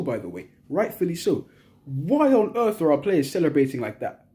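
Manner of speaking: usual speaking voice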